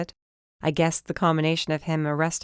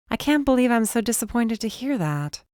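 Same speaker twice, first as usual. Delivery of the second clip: sighing; 7 semitones higher than usual. A narrator's reading. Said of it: "I sighed".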